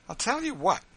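put-on high, loud boyish voice; a boy blurting out his story; Master Bill. Grown son recalling his mother's punishment, high and boyish. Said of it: "Master Bill used to say".

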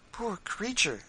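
high, pitying exclamation; compassionate. A narrator's reading. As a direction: feel for her, voice lifted high. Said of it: "said Missus Bird compassionately".

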